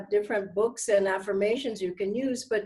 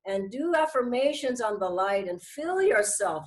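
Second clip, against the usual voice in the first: ordinary delivery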